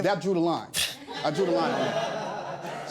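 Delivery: chuckling through the words; laughing while talking. Real, not acted. Laughing off the insult.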